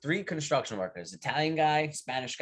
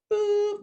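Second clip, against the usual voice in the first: high-pitched imitated whistle toot; lunch whistle; 4 dB louder than usual. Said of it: "whistle goes".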